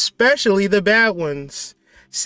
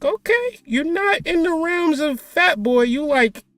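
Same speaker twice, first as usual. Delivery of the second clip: much higher, playful voice talking to his reflection; teasing mirror self-talk; 7 semitones higher than usual. Pretend. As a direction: tease yourself in the mirror in a high voice.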